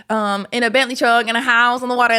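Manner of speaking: usual speaking voice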